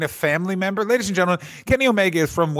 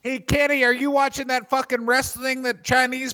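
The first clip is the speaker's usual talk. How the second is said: high, jeering Canadian bully voice; 10 semitones higher than usual. Retold or imitated. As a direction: jeer like a small-town tough. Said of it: "guys like this".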